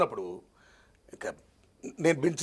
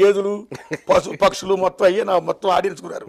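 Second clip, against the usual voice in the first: normal talking voice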